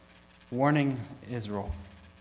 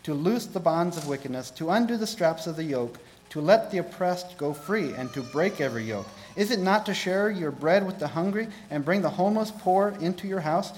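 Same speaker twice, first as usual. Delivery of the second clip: higher, louder, emphatic proclamation; forceful scripture reading; 5 semitones higher, 7 dB louder than usual. Acted. Preacher reads God's true fast to congregation, emphatic proclamation. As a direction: declaim each phrase like a preacher reading prophecy.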